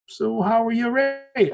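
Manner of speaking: a light, slow hint of an Irish accent; Irish cab driver. Belfast cab driver quizzing his passenger, light Irish accent. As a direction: slip briefly into the cabbie's Irish accent, drawn out. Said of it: "I'm not gonna do his Irish accent".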